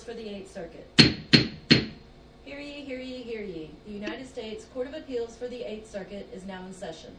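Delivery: formal ceremonial court cry; town crier. Acted. Clerk opens court with cry.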